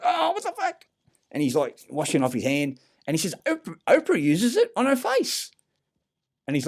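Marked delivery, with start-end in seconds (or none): 0.0-0.9 high-pitched squeal of disgust; shocked outcry. Retold or imitated. Comedian recoiling from cream on his hand, high shocked squeal. 3.5-5.5 high, louder, disbelieving cry; incredulous exclamation. Retold or imitated. Comedian exclaiming about Oprah.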